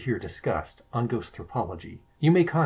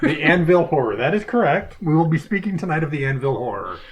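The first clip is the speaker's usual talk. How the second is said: higher, louder mock-solemn announcement; teasing formal host voice; mock-formal presenter; 5 semitones higher, 4 dB louder than usual. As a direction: announce the botched title with pomp. Mock-formal echo of the mistake.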